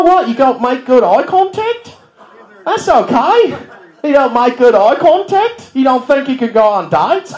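high, slow, over-the-top Aussie cheer; chirpy Australian TV host gushing; mock Australian reassurance, high and slow; Australian accent. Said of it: "they're like".

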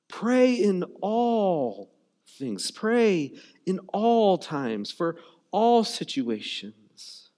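a preacher spacing out the command; slow, weighty. Real, not acted. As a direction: pray in all things, drawn out slowly.

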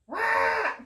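very high squawking cry; cartoon bird squawk. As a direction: squawk like a hungry bird swooping. Hungry bird lunges at flea.

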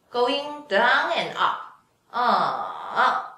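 "ah" slow, dipping down, then rising up; third tone demo. Shown as a demonstration. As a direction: slide the "ah" down and back up, slowly. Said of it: "make the syllable the third tone".

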